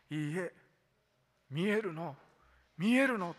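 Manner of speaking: rising to a loud cry of denial; mild mock shout. A narrator's reading. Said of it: "大声で叫んで". (否定して次第に高く叫ぶ)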